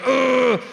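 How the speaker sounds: straining grunt. Retold or imitated. Doctor squeezing a patient, acted straining grunt. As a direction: grunt as if squeezing really hard. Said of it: "their teeth clench".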